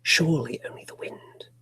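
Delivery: hushed ghost-story whisper; whispered narration. Acted. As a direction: whisper it close and eerie.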